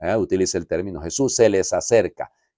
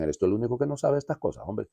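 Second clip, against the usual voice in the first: annoyed quote; 2 semitones higher, 7 dB quieter, faster than usual. Retold or imitated. Disciple snapping at the stranger, quick and exasperated. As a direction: rattle it off as if fed up.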